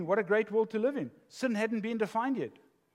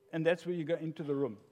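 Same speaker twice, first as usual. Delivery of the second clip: ordinary delivery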